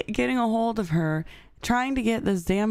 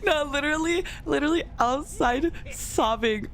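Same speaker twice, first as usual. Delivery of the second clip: laughing through tears; 7 semitones higher than usual. Real, not acted. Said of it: "I was literally hysterical outside".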